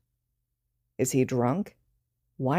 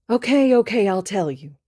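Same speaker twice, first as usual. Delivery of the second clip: high, loud panicked give-in; hasty surrender; 5 semitones higher, 5 dB louder than usual. Acted. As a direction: cave in fast before one. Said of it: "Midoriya surrenders".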